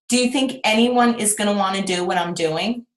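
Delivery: usual speaking voice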